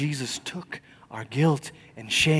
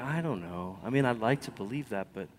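doubtful murmur; 2 semitones lower, 9 dB quieter than usual. Retold or imitated. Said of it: "you're like".

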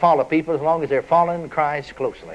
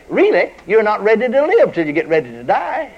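ordinary delivery

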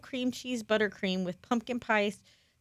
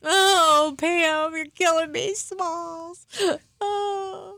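high excited moan; 8 semitones higher, much slower than usual. Real, not acted. Overwhelmed by cupcakes.

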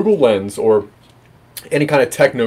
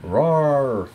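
small half-hearted ghost roar; a soft little mock roar; tiny squeaky trying to roar; 5 dB quieter than usual. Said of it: "and this is just a ghost".

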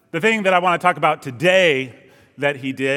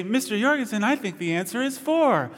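high, soft mock schoolgirl voice; blonde schoolgirl; 5 semitones higher, 5 dB quieter than usual. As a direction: imitate a girl sweetly offering an answer, pitched up and soft. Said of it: "blonde girl would raise her hand and would say".

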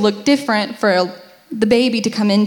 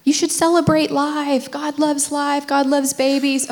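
higher, lightly preachy reassuring voice; imitating well-meaning believers; 5 semitones higher than usual. Wife mocking what listeners are thinking, light preachy imitation. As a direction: mimic the pious advice people give. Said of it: "I know what you're thinking".

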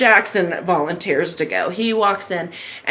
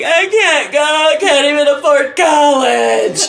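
high, loud comic wail; imitating your own tearful panic; mock crying; 5 semitones higher, 4 dB louder than usual. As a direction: bawl it out as a hammed-up meltdown. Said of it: "I'm crying".

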